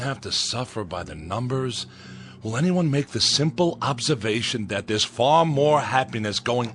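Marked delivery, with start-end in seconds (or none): 2.4-6.8 louder, higher, pressing the crowd; impassioned challenge. Acted. Al challenging the mourners, impassioned louder challenge. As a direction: demand an answer from everyone.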